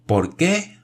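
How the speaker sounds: shouted. A narrator's reading. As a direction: yell the question in astonishment. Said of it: "gritó Michel". (grito agudo de asombro)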